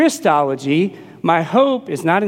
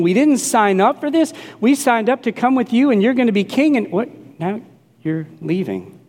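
whining disciples; 6 semitones higher than usual. Retold or imitated. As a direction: protest like let-down followers. Disciples complaining Jesus leaves.

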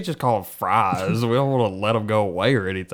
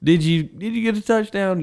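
mock coach; 10 semitones higher than usual.

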